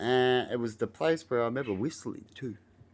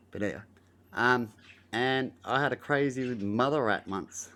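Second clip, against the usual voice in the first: ordinary talk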